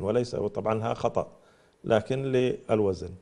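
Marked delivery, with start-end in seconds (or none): none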